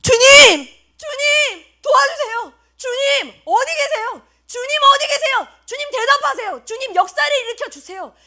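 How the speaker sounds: high-pitched pleading calls to the Lord; desperate prayer. Acted. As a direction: plead urgently as a suffering believer praying. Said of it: "이렇게 기도하지 않았겠어요?".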